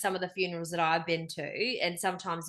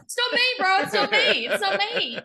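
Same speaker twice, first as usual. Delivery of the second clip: high, loud mock panic through laughter; giggling as a nervous Steve denying it all; mock denial laughing; 13 semitones higher, 8 dB louder than usual. Imitating the Steves at the funeral, laughing hard.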